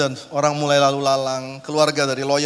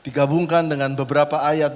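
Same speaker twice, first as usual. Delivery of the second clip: ordinary talk, nothing marked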